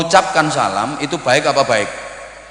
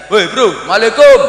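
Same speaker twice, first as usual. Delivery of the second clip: loud, high holler; street greeting to a buddy; 8 semitones higher, 8 dB louder than usual. Shown as a demonstration. Friend meeting friend on the street, rowdy high shout. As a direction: yell it casually to a pal across the road. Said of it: "Ketemu temen di tengah jalan, gayanya beda lagi".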